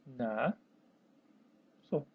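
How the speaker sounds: "Na, so" as two isolated syllables; "Na, so" dictated slowly, low. Shown as a demonstration. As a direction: leave a long pause between "Na" and "so". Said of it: "Como se separa?".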